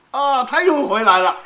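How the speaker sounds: ordinary delivery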